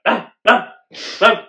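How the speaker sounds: loud, high yelps imitating someone; imitated yelps. Mimicking that guy from the inbred family, loud high yelps. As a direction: yelp out short bursts in imitation. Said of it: "that guy's like".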